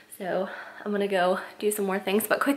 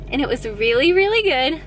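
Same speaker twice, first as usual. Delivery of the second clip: second really rising high and loud; excited; 5 semitones higher, 5 dB louder than usual. Real, not acted. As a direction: gush over the food. Raving about Thai food.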